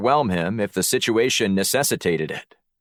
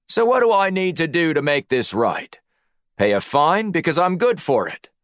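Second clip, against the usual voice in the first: much higher, matey Aussie voice; Australian accent; 8 semitones higher than usual. A narrator's reading. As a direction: lay on a put-on Australian accent. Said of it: "summoned his best Australian accent".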